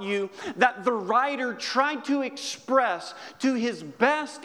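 fervent preaching. Acted. Preacher urging congregation about God's care, impassioned and high. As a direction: build it with awe.

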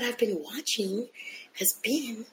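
unvoiced whisper; whispering on the phone. Real, not acted. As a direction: drop to a whisper so he can't hear. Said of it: "Why are you whispering?".

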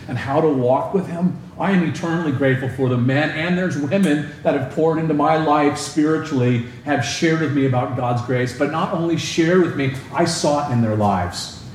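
ordinary delivery

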